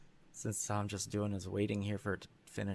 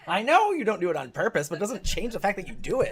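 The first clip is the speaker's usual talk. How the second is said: laughing mock retort; 7 semitones higher, 9 dB louder than usual. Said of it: "I'm like".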